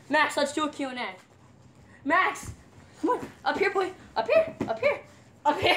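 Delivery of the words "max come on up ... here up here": calling the dog up, pitch climbing, laughing; high coaxing call. Real, not acted. Calling the dog Max up onto her lap, coaxing and laughing. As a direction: coax the puppy up onto you with rising calls.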